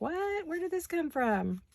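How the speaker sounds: high, slow incredulous what; amused retelling of being caught off guard; mock surprise. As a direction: replay the moment of baffled shock, lightly hammed. Funny story about sudden rain.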